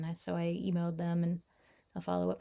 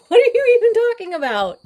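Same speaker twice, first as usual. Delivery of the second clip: mock disbelief; 16 dB louder than usual. Real, not acted. Vlogger mock-asks the cats.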